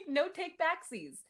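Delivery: squeaky, slow, teasing no take backsies; high mock kid voice. [name] mocking Rodney's rematch demand in a high squeaky voice. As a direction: play the smug winner in a high childish voice.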